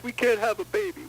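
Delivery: high-pitched wail of an imitated wife; mock crying wife. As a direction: whine it high, playing the tearful wife. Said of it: "she's crying".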